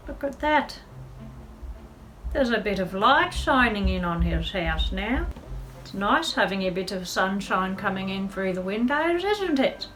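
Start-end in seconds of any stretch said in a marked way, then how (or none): none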